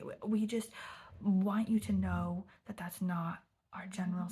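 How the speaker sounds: hammed-up, pleading apology with drawn-out pauses; overly intensely apologetic. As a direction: imitate a young worker earnestly over-apologizing. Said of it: "overly intensely apologetic and ridiculous".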